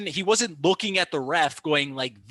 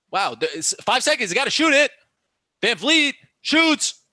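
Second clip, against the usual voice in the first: rising, louder shot-clock call; excited play-by-play; 9 semitones higher, 6 dB louder than usual. Real, not acted. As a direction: call the shot with urgency, pitch climbing. Live call of late shot.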